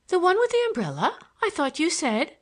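high-pitched puzzled sister; puzzled question. A narrator's reading. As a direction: query it in a high, puzzled lilt. Sister replies in puzzled surprise.

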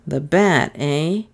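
plain reading voice

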